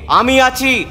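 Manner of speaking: loud, high call to a mother; calling out reassurance. A narrator's reading. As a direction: call out boldly to calm her. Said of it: "তোমায় বলছি ডেকে".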